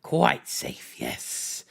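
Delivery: breathy creature whisper; whispered Gollum. A narrator's reading. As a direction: hiss it softly to himself. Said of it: "he whispered to himself".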